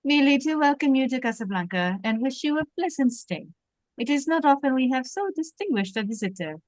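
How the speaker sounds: put-on lightly French-accented police captain. Captain greets a famous visitor at his table, French accent. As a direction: play Renault with a French accent. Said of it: "I think you do the accent".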